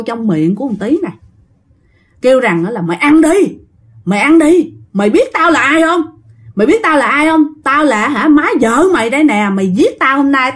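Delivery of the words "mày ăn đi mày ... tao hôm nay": vengeful ghost. Acted. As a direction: force him to eat, screaming. Ghost mother-in-law attacking Tý.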